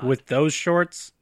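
very slow, dragged-out question; slow motion. Pretend. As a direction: stretch every word out slowly. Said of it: "why is everyone speaking in slow motion".

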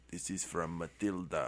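low, very soft imitated movie line; dying last words. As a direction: murmur it like a hero's parting words. Quoting dying hero's last line.

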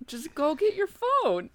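high, slow, insistent mock husband; imitating husband. Wife retelling her husband's order, mock insistent. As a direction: draw it out like a stoned husband insisting. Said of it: "He's like".

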